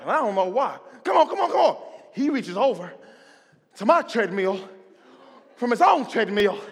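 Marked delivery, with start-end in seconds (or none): 1.0-1.8 high, loud rapid cheering; loud hype. Retold or imitated. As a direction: keep pushing, laughing. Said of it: "He's loud".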